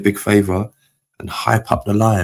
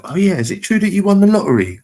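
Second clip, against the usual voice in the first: leaps way up in pitch, eager and louder; excited disbelief; 11 semitones higher, 4 dB louder than usual. Real, not acted. Streamer asks viewer Unsub if he won the lottery, high excited disbelief. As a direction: pounce on the rumour with high delight.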